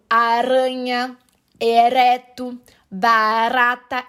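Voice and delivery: "aranha, ereto, barata" slowly, stretching the tapped R; rolled R. Shown as a demonstration. Teacher illustrating Portuguese R, well rolled and drawn out. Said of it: "esse R bem enrolado".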